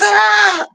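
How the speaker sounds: put-on high shriek of a scared little girl; mock scream. Little girl scared by the roar, shrieking high. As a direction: shriek up high like a startled child.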